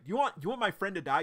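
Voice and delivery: angry yell. Retold or imitated. Remy yelling at Grayson.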